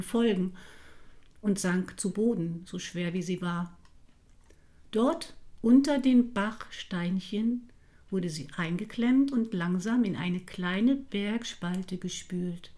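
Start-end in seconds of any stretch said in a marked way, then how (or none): none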